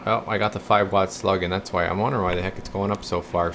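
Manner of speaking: usual speaking voice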